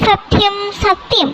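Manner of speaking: slow, deliberate vow; solemn vow. Acted. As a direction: swear it slowly, word by word.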